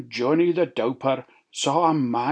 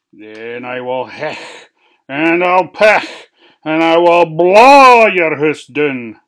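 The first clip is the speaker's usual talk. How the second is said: wolf threat; 10 dB louder than usual. Acted. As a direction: menace that swells to a roar.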